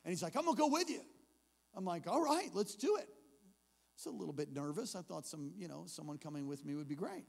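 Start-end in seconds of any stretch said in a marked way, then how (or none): 0.4-1.0 high, loud eager volunteering; eager kid. Retold or imitated. Kid volunteering to go along, eager. 2.1-3.1 high, louder upbeat yes; game agreement. Retold or imitated. Preacher accepting the kid's company, game and upbeat. Agreeing gamely in retold story.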